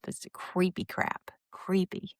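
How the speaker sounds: slow, whispered disgust; creeped-out whisper. Real, not acted. Vlogger recoiling from Cody's fake-smile gesture, whispered. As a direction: breathe it out slowly, creeped out.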